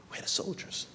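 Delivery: hushed whispered question. Retold or imitated. At the wall, asking his contact in a whisper. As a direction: lean in and whisper it.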